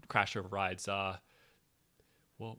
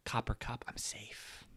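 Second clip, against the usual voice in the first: whispered; 7 dB quieter, slower than usual. Pretend. Mock cozy safety.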